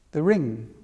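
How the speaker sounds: normal talking voice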